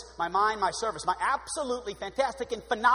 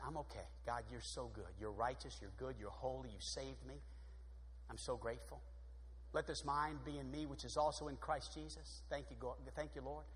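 low, hushed private prayer; grateful prayer; 9 semitones lower, 16 dB quieter than usual. Acted. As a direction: murmur thanks to God, very softly. Acted grateful prayer to God.

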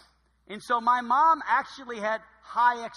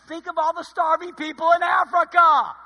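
high, loud nagging mom; guilt-trip like a mother at dinner; mock dinner scolding; 9 semitones higher, 10 dB louder than usual. Preacher imitating mom at dinner, high and loud.

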